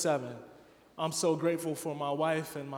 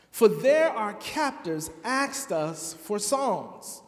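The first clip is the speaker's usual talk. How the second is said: dramatic scripture reading; 3 semitones higher, 4 dB louder than usual. Acted. Pastor reading Psalm 137 to the congregation, dramatic lament. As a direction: read the psalm like a lament from exile, building to outcry.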